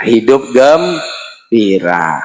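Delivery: normal talking voice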